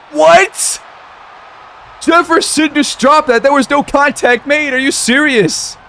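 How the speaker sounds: high, outraged disbelief; incredulous outburst. Real, not acted. Commentator aghast at Jefferson's dropped pass, incredulous outburst. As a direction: cry out in high-pitched, indignant disbelief. Player's receiver drops an easy pass.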